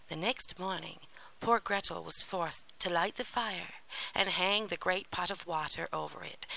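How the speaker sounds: ordinary delivery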